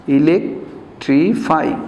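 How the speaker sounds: Electrify said slowly, syllable by syllable; teacher drawing out electrify; slow demo word. Shown as a demonstration. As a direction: stretch out electrify for the class.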